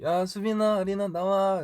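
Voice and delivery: high, loud call down a hallway; imitated calling out. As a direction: imitate the writer summoning performers. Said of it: "이렇게 계속 이렇게 부르시는 말이에요". (높고 크게 부르는 작가님 흉내)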